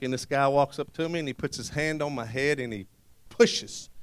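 normal talking voice

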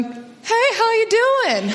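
high, cheery hello; high and bright, imitating her cheerful child self; chipper kid greeting. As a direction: greet the police like a sunny kid.

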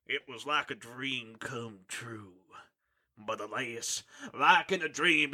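a hint of a grave vampire dad telling his tale; lightly voiced dramatic father.

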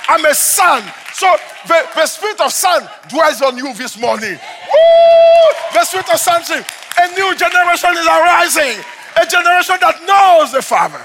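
shouted, high, fervent preaching; preaching at full force. Acted. Pastor shouting his testimony to the congregation, full-force preaching. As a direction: proclaim it to the whole church.

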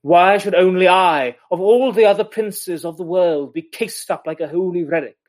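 defiant Duchess. Acted. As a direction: read it as a duchess demanding her freedom. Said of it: "she sort of says".

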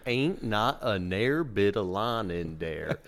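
very slow imitation Southern drawl; slowed-down Southern dialect.

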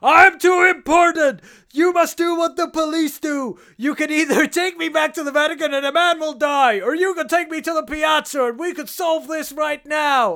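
high, loud mock bluster; self-important hero. Film hero berating a policeman, mock self-important shouting. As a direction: declaim it like a pompous hero, hammed-up shouting.

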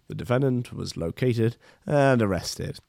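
ordinary talk, nothing marked

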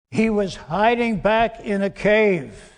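ordinary talk

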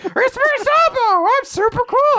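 very high cartoon falsetto; silly squeaky puppet voice; Sabo. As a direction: squeal it like a dubbed-over cardboard cutout. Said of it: "somebody talking behind it".

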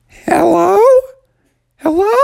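put-on high-pitched kid's call; Bubby calling out. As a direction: yell it high into the dark cave. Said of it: "Bubby yelled out".

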